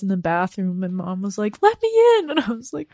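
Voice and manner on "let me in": mom calling. Retold or imitated. Mom at locked bathroom door.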